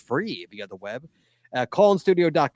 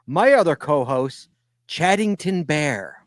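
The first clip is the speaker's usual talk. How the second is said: loud start, then a drawn-out name; announcer introduction; 4 semitones higher, 4 dB louder than usual. Acted. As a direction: present your co-host with showy emphasis. Introduces co-host with new name.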